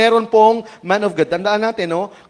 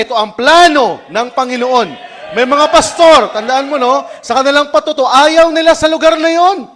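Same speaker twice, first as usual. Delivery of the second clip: loud, higher preaching build; fiery preaching; 6 semitones higher, 7 dB louder than usual. Acted. Preacher scolding reluctant servants before the church, loud and fiery. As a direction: press it on them with rising fire.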